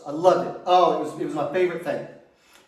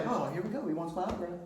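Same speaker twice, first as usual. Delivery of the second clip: much softer, half to himself; quiet self-satisfied aside; smug inner thought; 3 semitones lower, 12 dB quieter, faster than usual. Retold or imitated. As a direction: think it smugly under your breath. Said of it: "I was like".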